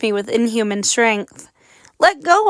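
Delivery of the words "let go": much higher, louder cry; girl crying out as she is grabbed; cried out. A narrator's reading. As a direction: cry out in panic. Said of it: "I cried".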